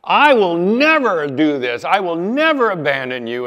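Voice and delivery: Peter jumping up to swear he'd never leave; Peter's vow. Retold or imitated. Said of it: "Peter jumps up and goes".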